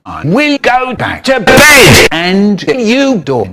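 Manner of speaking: loud slow spliced shout. Acted. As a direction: bellow it out, slowly.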